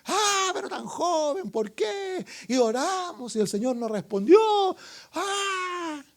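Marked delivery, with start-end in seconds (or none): 0.1-2.2 high, slow mock grieving; mock lament. Acting out mourners at funeral. 5.1-6.0 high mock wail «¡Ah!»; mock despair sigh. Acting mourners' despair.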